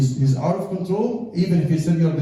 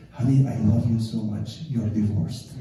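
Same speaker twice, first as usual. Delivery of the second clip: mock tender; 5 semitones lower than usual. Husband brings wife a flower, mock lovingly divorces her. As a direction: deliver bad news like a love note. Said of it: "with a smile and say".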